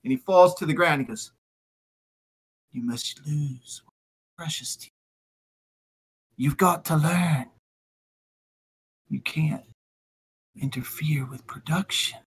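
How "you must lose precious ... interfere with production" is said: quiet menacing villain. Acted. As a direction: lean in and menace him softly. Tally man lectures coldly.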